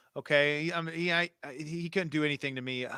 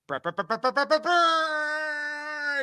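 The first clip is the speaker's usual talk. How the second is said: mock jingle; 12 semitones higher, 3 dB louder, much slower than usual. Jingle noise for the segment.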